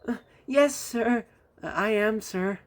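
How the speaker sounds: high, hesitant little voice; muffled timid reply. A narrator's reading. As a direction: stammer it from under the clothes. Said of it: "a muffled voice replied".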